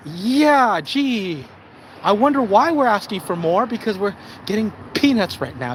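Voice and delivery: sarcastic retort. Acted. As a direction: feign puzzlement with heavy sarcasm. Mock reply to being called entitled.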